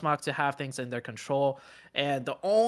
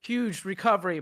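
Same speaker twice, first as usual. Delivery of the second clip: higher, louder, excited play-by-play burst; excited call; 7 semitones higher, 3 dB louder than usual. Real, not acted. Caster calling a surprise trade to the audience, excited and higher. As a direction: call the turnaround with rising excitement. Caster reacts to sudden fight swing.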